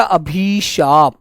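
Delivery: drawn-out dictation of अभिशाप; slow dictation. Shown as a demonstration. Teacher dictating अभिशाप to students, slowly drawn out. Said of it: "अभिशाप लिखकर दिखाना बेटा".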